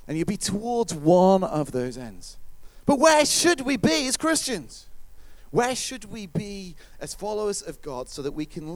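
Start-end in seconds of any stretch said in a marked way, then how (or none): none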